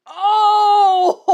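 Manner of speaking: high, loud laughing outcry; laughing cry. Real, not acted. Reader overwhelmed by the fic, laughing cry. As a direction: let out a cry that dissolves into laughter. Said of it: "laughing cry".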